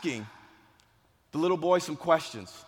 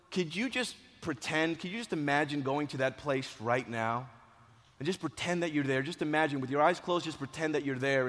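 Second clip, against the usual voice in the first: soft, lowered voice, gently coaxing; soothing guidance; 3 semitones lower, 6 dB quieter than usual. Retold or imitated. Coach calming an injured boy with closed eyes, soothing. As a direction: gently guide him into imagining. Guiding hurt boy to imagine.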